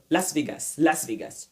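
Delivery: Las Vegas French-style, clear pure vowels, falling; "lass vé-GASS", no glide in Vegas; wrong pronunciation. Shown as a demonstration. Imitating their old habit, French-style Las Vegas. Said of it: "Non, on ne dit pas Las Vegas".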